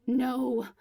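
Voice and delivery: loud desperate shout; holler. A narrator's reading. Alex hollers as Elias hangs up, desperate. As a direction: yell as the line goes dead. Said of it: "I holler".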